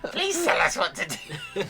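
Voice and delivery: high, slow laughing whine; mock desperate plea.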